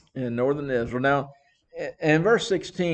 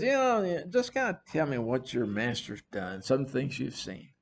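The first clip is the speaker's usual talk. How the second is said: laid-back king; 8 dB quieter than usual. Acted. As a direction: lean back and ask idly, like a relaxed king. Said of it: "I'm gonna see this kind of sitting back".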